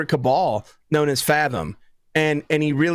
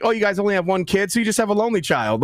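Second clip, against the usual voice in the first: quick mock quote; 3 semitones higher, faster than usual. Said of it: "it's like".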